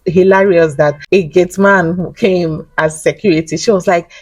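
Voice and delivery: ordinary delivery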